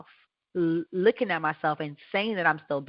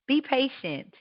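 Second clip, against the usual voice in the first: ordinary talk, nothing marked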